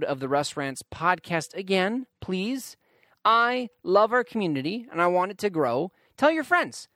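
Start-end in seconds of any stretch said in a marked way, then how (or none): 6.2-6.9 eager plug. Real, not acted. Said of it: "podcast plug".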